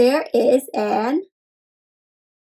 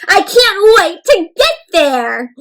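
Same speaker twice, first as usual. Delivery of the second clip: high, loud, drawn-out eagerness; bursting with anticipation; loud and excited; 7 semitones higher, 6 dB louder than usual. A narrator's reading. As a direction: exclaim it slowly with big excitement. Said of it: "I read them really loud and excitedly".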